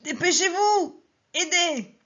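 high strained shout under the weight; muffled cry. Acted. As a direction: call out with a last effort. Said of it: "une voix étouffée qui criait".

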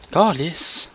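ordinary delivery

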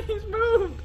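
high, giddy laugh into words; squealed words after a shocked laugh; startled laughing. Real, not acted. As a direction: laugh through the fright.

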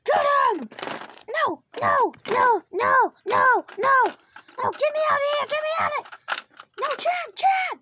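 loud high desperate yells; terrified pleading shouts; panicked screaming. Real, not acted. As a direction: scream to be freed. Being captured, screaming.

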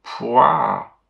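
grossed-out "pouah"; disgusted retch. Acted. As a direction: gag in disgust. Disgust at taste.

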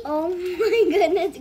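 loud, slow oh my goodness; surprised. Real, not acted. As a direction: stretch it out in shock. Candy spills.

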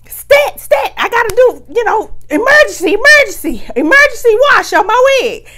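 panicked calling out; frantic hollering. Retold or imitated. Rushing to wash the wig, frantic high holler. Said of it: "I'm rushing".

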